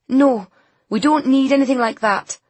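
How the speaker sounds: put-on loud, defiant teenager. A narrator's reading. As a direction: shut her down hard. Teen defiant refusal.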